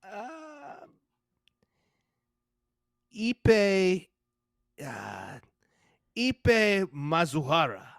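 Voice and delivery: Ipe Mazuhara sounded out slowly, syllable by syllable; unsure attempt at a Japanese name; careful Japanese name. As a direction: pronounce Ipe Mazuhara carefully, ee-peh mah-zoo-hah-rah. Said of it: "Say his name".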